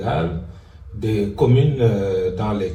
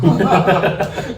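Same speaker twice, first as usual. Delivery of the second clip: laugh; 10 semitones higher, 8 dB louder than usual. Real, not acted. Laughs at own confusion.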